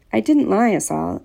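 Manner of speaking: usual speaking voice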